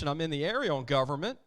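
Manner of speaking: ordinary talk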